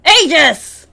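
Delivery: loud, high call of the name; calling out to greet a friend; excited shout-out. Real, not acted. Greeting a chatter in chat, a loud happy call.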